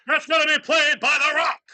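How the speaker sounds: big ring announcer yell; wrestling announcer shout. Acted. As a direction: hype it like a wrestling intro.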